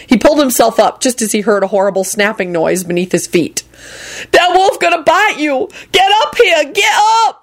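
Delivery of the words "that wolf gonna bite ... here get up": high, frantic screamed warning; screaming. A narrator's reading. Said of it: "Lucretia screamed".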